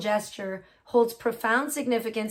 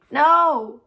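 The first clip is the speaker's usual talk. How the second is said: sharp loud no at the computer; fed-up cry at a stubborn machine; frustrated shout; 4 semitones higher, 9 dB louder than usual. Real, not acted. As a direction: yell it in real frustration. Yelling at a broken computer.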